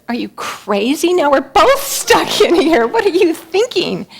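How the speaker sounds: high, loud exasperated yell; incredulous outburst. Acted. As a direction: scold him for jumping in. Man scolds friend in hole.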